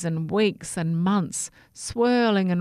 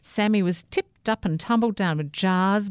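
usual speaking voice

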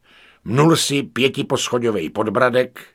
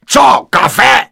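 high, loud outraged bellow; outraged roar; 13 semitones higher, 8 dB louder than usual. A narrator's reading. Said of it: "zařval". (pobouřený řev, vysoko a nahlas)

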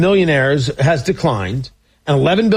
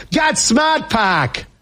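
ad voice quote; 7 semitones higher, much slower than usual. Retold or imitated. Quoting a car commercial.

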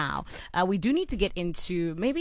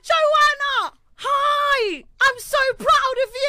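high-pitched cheering imitation of a proud dad; loud British dad; 4 dB louder, slower than usual. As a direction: shout proudly across a netball court, hammed-up. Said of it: "the loudest person in the room".

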